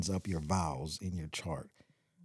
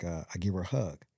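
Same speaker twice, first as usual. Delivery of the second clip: ordinary talk, nothing marked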